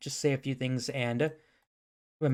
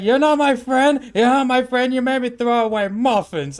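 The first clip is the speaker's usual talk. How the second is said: hammed-up wail; 10 semitones higher, 9 dB louder than usual. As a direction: wail it in mock heartbreak. Acts Thor wailing before he collapses.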